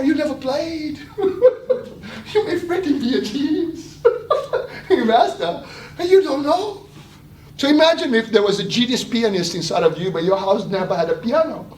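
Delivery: words bubbling with laughter; laughing while talking. Real, not acted. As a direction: talk through real giggles. Laughing through his own point.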